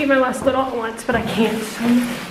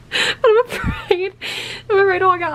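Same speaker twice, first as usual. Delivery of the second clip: tearful; 6 semitones higher than usual. Real, not acted. Vlogger afraid to walk out in torn jeans, near tears. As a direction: let the voice crack with worry. Embarrassed about ripped jeans.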